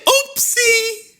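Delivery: very high, sing-song oops; barber's oopsie. Acted. Barber drops a big tuft of hair: very high sing-song oopsie. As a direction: chirp oopsie like a guilty child. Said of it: "She just say".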